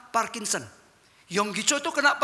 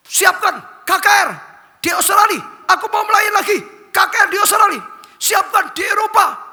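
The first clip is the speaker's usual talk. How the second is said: ordering on the phone; 11 semitones higher, 10 dB louder than usual. Retold or imitated. Healed pastor phoning Australia to book crusades, loud high shouts. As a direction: bark the plans down the phone. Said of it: "Lalu dia telepon".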